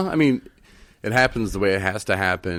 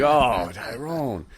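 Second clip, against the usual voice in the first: exasperated; 4 semitones higher, much slower than usual. Retold or imitated. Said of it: "I was like".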